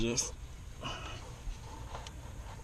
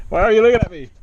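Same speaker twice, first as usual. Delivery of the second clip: much higher and louder, amused; playful outburst at the cat; 9 semitones higher, 10 dB louder, faster than usual. Real, not acted. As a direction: burst out laughing at the staring cat. Cat staring, after a laugh.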